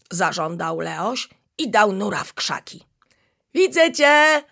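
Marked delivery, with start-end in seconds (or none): 3.5-4.4 high, louder teasing laugh in the voice; chameleon laughing as he spots the hidden cub. Acted. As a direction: gleefully catch him out. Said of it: "zaśmiał się Magi".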